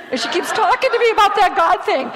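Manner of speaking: amused, chuckling as you speak; laughing. Retold or imitated.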